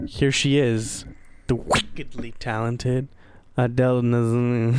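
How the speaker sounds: slow, drawn-out awards show introduction; mock stage introduction, very slow; John Travolta. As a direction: imitate a presenter stretching out each word of a grand introduction. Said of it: "So he's like".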